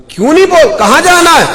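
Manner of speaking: high, loud angry demand; angry uncle. Retold or imitated. Uncle angrily confronts nephew who wants to leave, loud and high. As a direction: bark it at the nephew from above.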